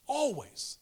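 high, loud single-word punch; emphatic always. Acted. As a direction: drive the one word home. Preacher hammers home one word.

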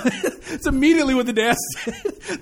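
amused, laughing as the words come out; real chuckle breaking into the sentence. Real, not acted. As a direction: talk through a real laugh. Laughs while telling the story.

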